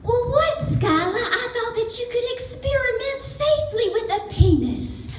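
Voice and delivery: very high-pitched Southern mom impression; hammed-up high Southern mother voice; sweet Southern accent. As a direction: squeal it like a defensive Southern mama. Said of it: "in her sweet Southern accent".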